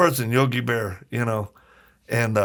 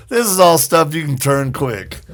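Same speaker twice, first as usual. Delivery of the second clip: teasing remark; 4 semitones higher, 8 dB louder than usual. Retold or imitated. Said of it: "I'm like".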